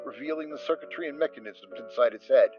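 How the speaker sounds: ordinary delivery, nothing marked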